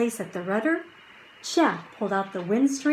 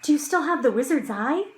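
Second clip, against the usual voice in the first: hurried question; 6 semitones higher, much faster than usual. Acted. Anxious fast question.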